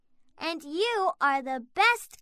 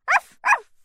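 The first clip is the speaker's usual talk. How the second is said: puppy barking; 13 semitones higher, 8 dB louder than usual. Acted. Puppy barks.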